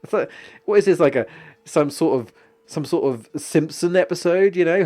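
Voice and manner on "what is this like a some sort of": normal talking voice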